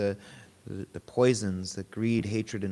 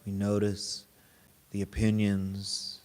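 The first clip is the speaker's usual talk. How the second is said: guided meditation; 3 semitones lower, 3 dB quieter than usual. Real, not acted. Teacher guiding a group to notice body and breath, guided meditation. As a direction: lead them gently inward, unhurried and even.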